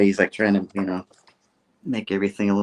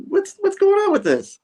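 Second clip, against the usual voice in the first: very high, louder; mock outrage; 5 dB louder than usual. Peter protests about Jonah, very high and loud. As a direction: squeal the complaint. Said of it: "He's like".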